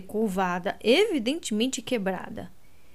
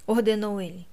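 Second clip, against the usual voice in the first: usual speaking voice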